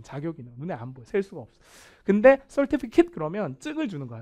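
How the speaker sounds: usual speaking voice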